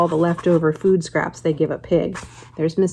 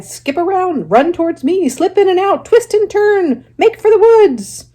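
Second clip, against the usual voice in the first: much higher, louder goose yelling; the goose yells escape orders, very high and loud; shrill goose cry; 12 semitones higher, 7 dB louder than usual. Acted. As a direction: squawk the orders at full pitch. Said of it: "she cried".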